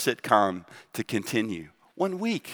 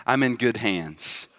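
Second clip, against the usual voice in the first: ordinary talk, nothing marked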